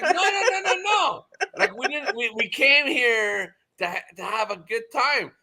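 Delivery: very high, squeaky begging, calming toward the end; frantic pleading. Retold or imitated. Pleading in retold scene.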